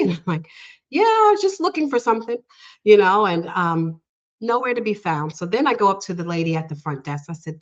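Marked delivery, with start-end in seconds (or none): 0.9-2.4 high, quick, acted-out brush-off; casual cover-up. Assistant caught searching answers the doctor, high quick cover-up. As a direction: toss it off high and fast to cover up.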